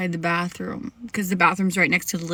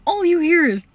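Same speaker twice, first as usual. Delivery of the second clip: words spilling out through a laugh, pitched up; can't hold back the giggle while telling it; 7 semitones higher, 4 dB louder than usual. Real, not acted. Laughing while retelling the sound.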